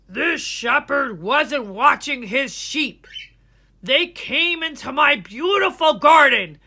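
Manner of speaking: angry farmer. Acted. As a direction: rant like a farmer whose garden is ruined. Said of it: "He was very angry".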